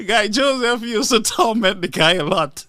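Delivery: giggling through the words; can barely speak for laughing; real laughter breaking the line; laughing fit. Real, not acted. Speaker can't stop laughing.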